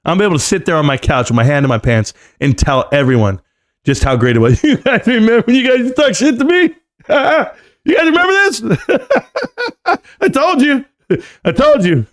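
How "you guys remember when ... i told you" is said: high-pitched gloating through laughter; laughing gloat. Acted. Streamer crowing to viewers who doubted him, laughing gloat. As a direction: laugh at the doubters as you crow.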